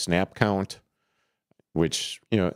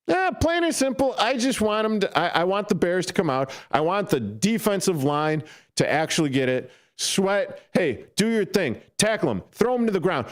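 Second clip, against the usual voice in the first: excited high cheering; 12 semitones higher than usual. Acted. Cheering the defense on.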